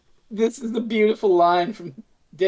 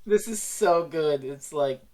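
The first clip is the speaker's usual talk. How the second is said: ordinary delivery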